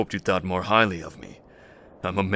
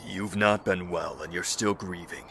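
normal talking voice

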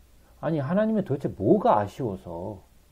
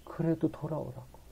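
hushed plea; 2 semitones higher, 9 dB quieter than usual. Acted. Voices God's final plea.

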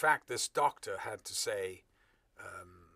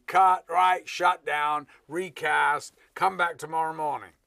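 loud, high calls in short bursts; director's shouted orders; 6 semitones higher, 12 dB louder than usual. Retold or imitated. Director shuts down and recasts, shouting orders. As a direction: bark out orders as if halting a film set. Director acted shutting down shoot.

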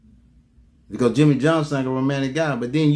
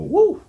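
high woo; 13 semitones higher, 4 dB louder than usual. Real, not acted. Preacher's exclamation.

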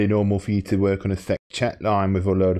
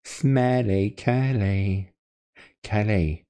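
1 taunting singsong; much slower than usual. Pretend. Taunting prank caller.

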